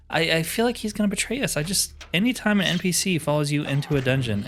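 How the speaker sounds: ordinary talk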